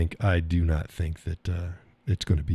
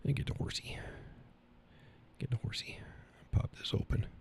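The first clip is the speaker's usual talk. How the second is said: voiceless whisper under his breath; whispering to himself; 6 semitones higher, 15 dB quieter, faster than usual. Real, not acted. Maker whispers to himself while digging out the horsey. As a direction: whisper it while busy with your hands. Muttering while fetching item off-mic.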